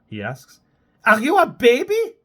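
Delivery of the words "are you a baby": high, loud shriek. Acted. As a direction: shriek it at him. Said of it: "she shrieks at him".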